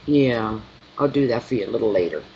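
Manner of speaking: normal talking voice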